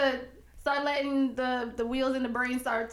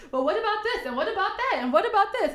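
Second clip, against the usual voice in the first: higher, nagging what-about questions; mocking questions; 7 semitones higher than usual. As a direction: mimic the pesky objections. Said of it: "since everybody had their".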